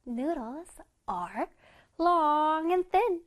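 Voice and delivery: very slow, drawn-out reading; slow choral reading. Shown as a demonstration. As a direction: read slowly along with the class. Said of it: "시작".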